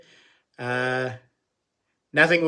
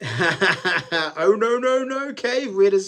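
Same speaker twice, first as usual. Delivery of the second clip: high, whiny put-on voice; mocking high voice; 6 semitones higher, 4 dB louder, much slower than usual. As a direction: read it like a teasing mate, pitched up. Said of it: "says Digger Mike".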